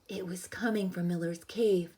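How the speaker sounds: normal talking voice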